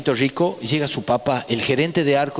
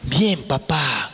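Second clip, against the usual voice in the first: niño tímido; 3 semitones lower, much slower than usual. Retold or imitated. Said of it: "el amiguito contesta".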